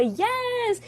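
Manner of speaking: high, hyped-up friend cheering you on; gushing acted encouragement; encouraging cheer. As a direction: whoop it up supportively, stretching the words. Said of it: "she'll encourage me".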